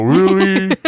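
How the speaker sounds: high, mushy numb-tongue imitation; numb-mouthed patient. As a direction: ask through a pretend numb mouth. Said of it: "I'm trying to talk to her".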